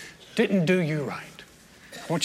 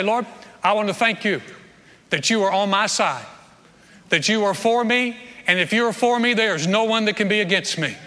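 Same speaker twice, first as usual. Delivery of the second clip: ringing, louder prayer full of conviction; fervent prayer; 4 semitones higher, 4 dB louder than usual. Shown as a demonstration. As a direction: pray it out with bold confidence. Models a bold prayer.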